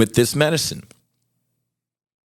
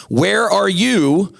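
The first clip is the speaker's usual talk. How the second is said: high, slow, drawn-out challenge; high mocking sing-song summons; taunting call-out; 9 semitones higher, slower than usual. Real, not acted. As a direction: call him out like a schoolyard taunt. Calling out governor.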